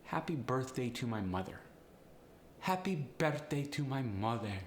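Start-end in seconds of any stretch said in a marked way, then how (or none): 2.6-4.6 Polish accent with slight upward inflection; Polish-accented, evenly stressed syllables. Shown as a demonstration. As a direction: lilt it like a Polish speaker. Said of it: "Polish sound was a slight upward inflection".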